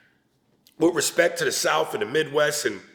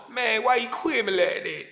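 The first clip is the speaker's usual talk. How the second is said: lightly put-on Southern accent; Southern drawl; 5 semitones higher than usual. Comedian imitating a Southern rapper, mild Southern accent. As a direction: mumble it in a slack Southern accent. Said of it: "That's the Southern version of".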